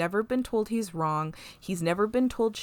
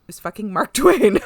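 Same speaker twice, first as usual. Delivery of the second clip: loud retold exclamation; 10 dB louder than usual. Podcaster retelling Guinan spotting the culprit, loud amused outburst. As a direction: blurt it out much louder, tipping into laughter. Retold punchline, breaks into laughter.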